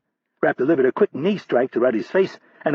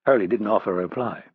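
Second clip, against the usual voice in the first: ordinary delivery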